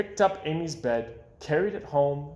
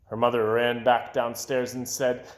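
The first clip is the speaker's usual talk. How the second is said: ordinary delivery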